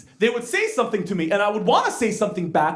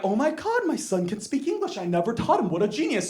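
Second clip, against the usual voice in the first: amazed mom; 6 dB quieter than usual. Retold or imitated. Mom marvels that her son speaks English, hushed awe. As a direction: imitate an awestruck mother, softly. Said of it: "my mom was like".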